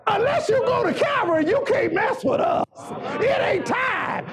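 high, driving pulpit voice as the devil; full-force preaching. Acted. Devil telling Jesus it is too early, preached at full force.

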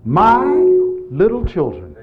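loud, slow, stretched-out address; drawn-out call. Acted. Preacher addressing his little children in scripture, loud drawn-out call. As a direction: call out slowly to the flock.

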